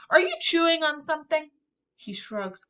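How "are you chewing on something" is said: higher, louder worried question; alarmed question. Acted. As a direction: demand an answer fast. Sister alarmed.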